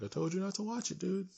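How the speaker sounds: exasperated scolding. Retold or imitated. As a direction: scold him like a fed-up friend. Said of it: "And I was like".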